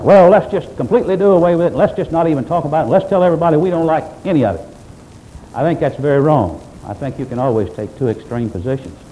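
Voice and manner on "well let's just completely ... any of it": imitating a dismissive preacher, louder and fast; hammed-up impatient preacher brushing Christmas aside; mock preacher. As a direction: rattle it off like a preacher waving it all away. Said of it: "sometimes preachers take a viewpoint".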